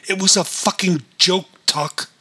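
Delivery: high, loud angry blurt. Acted. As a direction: burst out with it. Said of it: "David looked up and blurted".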